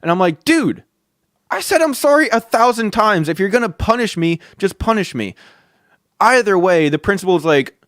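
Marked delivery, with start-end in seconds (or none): 0.4-5.3 high-pitched, exasperated protest; worked-up mock protest to a teacher; retold exasperation. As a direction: plead your case, fed up, as if retelling it.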